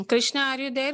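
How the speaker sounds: ordinary delivery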